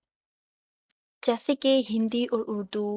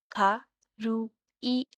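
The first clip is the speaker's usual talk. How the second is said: "खारुई" very slow, खा, रू, ई; syllable by syllable; much slower than usual. Shown as a demonstration. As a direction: leave clear gaps between the three syllables of "खारुई". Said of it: "three syllables". ("खारुई" तीन टुकड़ों में, रुक-रुककर)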